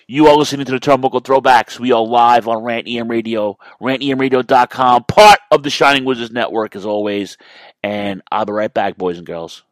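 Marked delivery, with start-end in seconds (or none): 0.1-6.8 loud, raised announcer voice; radio station ident. Acted. Host sending listeners to a break, big radio announcer delivery. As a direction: punch out the show plug with volume.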